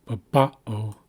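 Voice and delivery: slow "babao" with the middle "ba" emphasized; middle-syllable stress. Shown as a demonstration. Teacher demonstrating where emphasis goes, slow "babao" stressed in the middle. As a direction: say "babao" slowly, weight on the second syllable. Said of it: "and that's in the middle".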